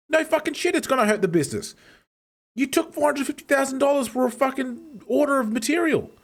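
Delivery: high-pitched exasperated rant, fast in places; heated rant. Real, not acted. Ranting about the CEO who stalled a customer's order, exasperated and high. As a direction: let the outrage spill over, voice climbing.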